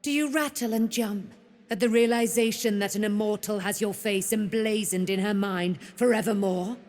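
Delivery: ordinary talk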